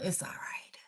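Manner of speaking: breathy whispered aside; whispering. Real, not acted. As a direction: whisper it off to the side.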